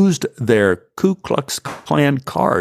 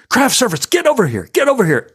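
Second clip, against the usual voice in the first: high bossy call across the set; 11 semitones higher, faster than usual. Retold or imitated. Assistant director calling the craft service kid, bossy shout. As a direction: summon the kid like a foreman. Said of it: "and he goes".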